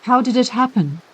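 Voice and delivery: plain reading voice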